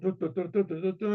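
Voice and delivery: du du du sung like a bright brass fanfare, rising at the end; mouthed trumpet fanfare. Retold or imitated. Storyteller announcing the king's unveiling, imitating a trumpet fanfare. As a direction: hum the royal trumpets, building up. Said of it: "and the trumpets sound".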